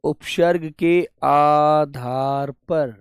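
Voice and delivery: उपसर्ग के आधार पर spelled out at half speed; slow dictation. Shown as a demonstration. Teacher dictating the heading slowly to students. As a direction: dictate each word slowly so students can write. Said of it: "अब उपसर्ग का ज्ञान तो आपको हो ही गया".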